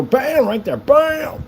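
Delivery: high, punchy "bam" exclamations; playful exclamation. Pretend. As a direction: shout it out like a triumphant sound effect. Calls out each connection made.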